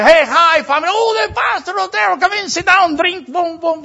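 high, loud festive welcome; loud, high-pitched welcome with a party shout; boisterous party host. Retold or imitated. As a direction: greet him like a rowdy host at a fiesta. Pastor voices partying Mexican family.

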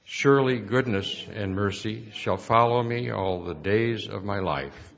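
ordinary delivery

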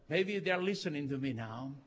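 ordinary talk, nothing marked

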